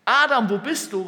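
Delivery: high, loud call. Acted. Preacher voices God calling Adam, calling out. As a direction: shout the name, then the question. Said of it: "wenn er da ruft".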